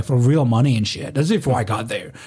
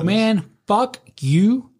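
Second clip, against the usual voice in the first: very slow, higher-pitched insult; drawn-out defiance; 7 semitones higher, much slower than usual. Retold or imitated. Doorman tossing a torn ID, slow savoured defiance. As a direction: stretch each word out to relish it. Retold insult after tearing the ID.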